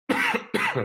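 harsh hacking cough; a real chesty cough. Real, not acted. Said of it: "sorry for the coughing".

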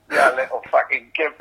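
normal talking voice

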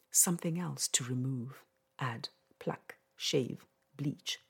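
measured spoken-word poetry reading; poem recitation. Acted. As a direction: recite each line with weight. Reads her poem aloud.

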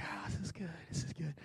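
very soft, self-satisfied aside; hushed, acted contentment; contented murmur. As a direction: sigh it quietly to yourself, relishing the comfort. Relishing a row to himself.

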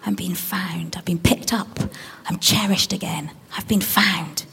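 soft whispered wonder from the found son; hushed whisper. Acted. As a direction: whisper it as if just rescued.

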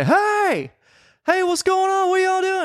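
high-pitched hollered greeting; mock holler from across a store; imitated stranger calling out. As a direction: call out high, imitating a surprise visitor.

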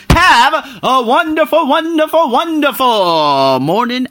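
high, slow, stretched wonderful; sing-song sign-off. Real, not acted. Vlogger signing off to viewers, sing-song. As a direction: croon the farewell to the audience.

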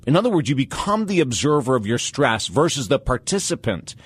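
ordinary delivery

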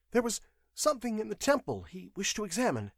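high, hurried confession; frightened witness, quick and high; nervous clerk. A narrator's reading. Clerk's voice in narrated story.

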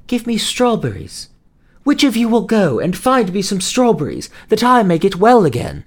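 put-on high, pleading young girl voice; sick girl pleading. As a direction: beg for them like a feverish child. Sick girl begs for strawberries.

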